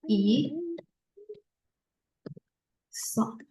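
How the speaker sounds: "i, so" split by a long pause; syllable-by-syllable demo. Shown as a demonstration. Teacher showing how it reads, "i, so" in separate syllables. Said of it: "Bacanya seperti ini".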